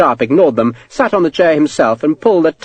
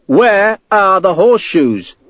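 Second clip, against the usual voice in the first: slow, higher-pitched, insistent demand; 7 semitones higher, slower than usual. A narrator's reading. Sharp pressing the sweating clerk, insistent. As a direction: spell it out slowly, demanding. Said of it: "Sharp insisted".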